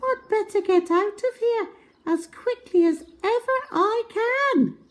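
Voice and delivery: high, fast and eager; high quick whisper-to-self energy; hurried little girl. Acted. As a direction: rush it out high. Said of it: "she said to herself".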